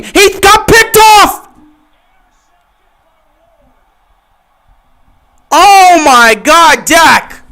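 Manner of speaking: high, loud and slow disbelief; shocked live call. Real, not acted. Streamer watching Dak throw a pick, shocked and loud. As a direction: drag out the words in horror at the pick.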